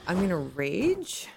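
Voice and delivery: ordinary talk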